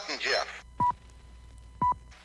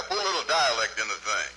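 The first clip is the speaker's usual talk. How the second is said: normal talking voice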